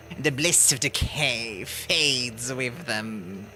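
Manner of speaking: slow, brooding necromancer voice; slow villain voice. Acted. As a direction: read it like a creepy scholar. Reads the necromancer's lines.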